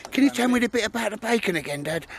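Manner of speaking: high-pitched mimicked pleading; imitating Baldrick. Speaker mimics Baldrick begging Dad for the bacon story, imitating. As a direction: put on a high little pestering voice. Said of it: "He, he kept saying to me".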